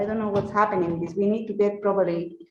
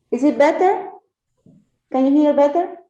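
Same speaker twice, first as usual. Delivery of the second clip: calling out; 6 semitones higher, 8 dB louder than usual. Real, not acted. Checking audio on a call.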